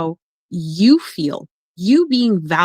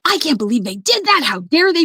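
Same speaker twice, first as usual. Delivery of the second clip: mock outrage; 8 semitones higher, faster than usual. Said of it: "she's like".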